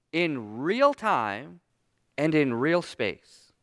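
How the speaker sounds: "real" drawn out slowly both times; slow down and press each "real"; stress on real. Shown as a demonstration. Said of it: "stressing the word real".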